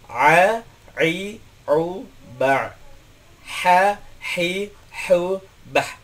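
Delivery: "A, i, u, ba" squeezed throat then "Ha, hi, hu" breathy; teacher drills both throat letters; Arabic ain and ha drill. Shown as a demonstration. Teacher repeating the drill for students, throaty onsets. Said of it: "Semuanya terfokus ke mulut".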